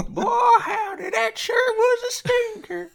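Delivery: very high, loud mock holler; loud, slow cartoonish yelp; hammed-up exclamation. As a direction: stretch it out in a silly, squealing put-on voice. Hammy reaction to failed impression.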